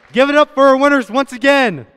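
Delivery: rally the room to clap; calling for applause. Real, not acted.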